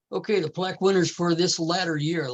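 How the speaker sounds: ordinary talk, nothing marked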